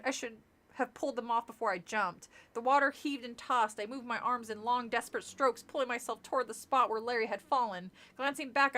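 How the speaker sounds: ordinary delivery, nothing marked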